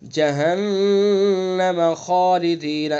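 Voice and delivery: slow "جہنم" with nasal hum on the doubled n; slow nasal ghunna demo. Shown as a demonstration. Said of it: "جہنم غنہ کریں گے".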